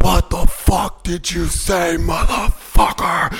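slow high furious yell; angry shout. Retold or imitated. Yelling at the man on the road, slow and furious. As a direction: bellow it at him, slow and high. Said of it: "I said".

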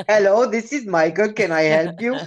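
mock Indian support-line accent; imitated call-center accent. Comcast support agent answering a call, mock Indian accent. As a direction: greet callers in a put-on Indian accent. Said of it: "it's the accent I hear every time".

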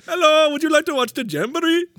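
high, loud hammed-up Middle Eastern accent; mock Middle Eastern accent. Disney cast member recommending the jamboree, mock Middle Eastern accent. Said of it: "use his real accent".

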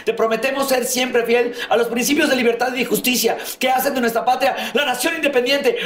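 high, declaiming pledge to the flag; school flag oath. Acted. Schoolboy reciting the flag oath to the whole school, proudly declaimed.

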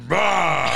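loud, high "bah" monster yell; mock monster roar; imitated haunted-house roar. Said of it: "he'd come out and go".

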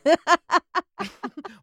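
bursts into loud, high laughter. Real, not acted. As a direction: cracks up, laughing hard.

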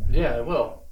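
deadpan sarcastic agreement. Real, not acted. Co-host promising to follow on Instagram, sarcastically. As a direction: agree without meaning a word of it. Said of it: "You said that very sarcastically".

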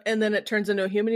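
ordinary talk

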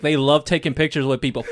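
ordinary talk, nothing marked

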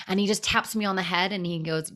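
ordinary talk

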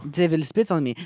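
put-on French accent. Telling of his trip to France, mock French accent. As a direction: keep up a hammy French accent. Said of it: "I also have my French accent".

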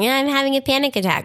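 high, quick and breezy, a throwaway line; mock casual complaint. Mocking how she says it all day, breezy and high. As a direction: toss it off like it's nothing. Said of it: "And I say all day long".

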